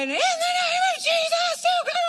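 very high, loud screamed command; retold yelling. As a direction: scream it out like a frantic exorcist, hammed up. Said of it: "You yell at him".

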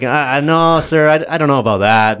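mock nervous kid turning him down. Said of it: "Kid's like".

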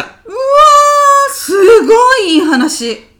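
mock sobbing, voice cracking high and loud; hammed-up weeping at a touching story; over-the-top crying over a heartwarming tale; tearful wail. Moved to tears by story.

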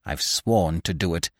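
low, soft, seething vow; contained rage. A narrator's reading. Widow vows revenge on her captive, low rage. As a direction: hold the fury down in a low voice. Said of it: "The widow stamped with rage".

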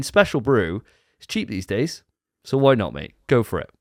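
normal talking voice

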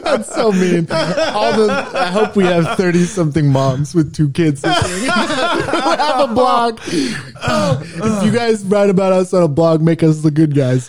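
talking through high laughter; giggly, can't stop laughing. Real, not acted.

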